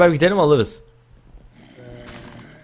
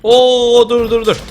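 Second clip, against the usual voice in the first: startled; 10 semitones higher, 7 dB louder, slower than usual. Real, not acted. Startled in the fight.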